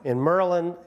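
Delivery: ordinary delivery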